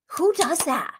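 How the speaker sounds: high-pitched incredulous question. Real, not acted. Host reacting to a scary cast member, incredulous.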